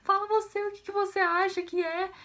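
high, lightly mock-weepy refusal; retold tearful reply. Crying girl telling mother to guess, retold tearful refusal. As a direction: push it back in an imitated crying voice.

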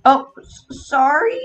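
ordinary talk

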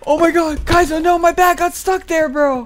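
high frantic yell; panicked yelling. Real, not acted. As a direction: shout in panic, voice cracking high. Teammate stuck, panicked yelling.

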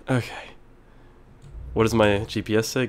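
normal talking voice

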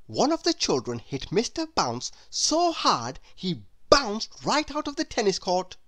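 ordinary delivery, nothing marked

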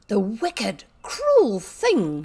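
acted outrage, words hit hard; passionate outburst. A narrator's reading. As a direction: burst out against the cruelty. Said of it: "she burst forth".

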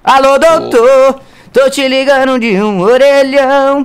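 slow, loud call down the line. Acted. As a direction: shout for the doctor. Chamando o doutor ao telefone. (chamando alto, arrastado)

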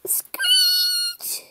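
piercing, very high screech; cat screech. Acted.

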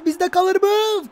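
high, slow anxious shout; will it stay with us, hold your breath; nervous excitement; tense excited shout. Real, not acted. Tense moment in attack.

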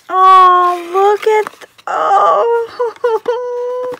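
very loud, high, teary squeal; real joyful cry, high and loud; excited cry. Real, not acted. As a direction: overcome with joy. Overwhelmed by a gift.

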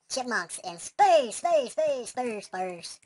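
slow, loud cry fading away; mock echo. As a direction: repeat SPACE as a falling, dying echo. Mock announcer echo in game.

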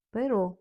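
Перо with the stress on the final о; stress on last syllable. Shown as a demonstration. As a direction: land on the end of Перо. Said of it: "Наголос на останній звук".

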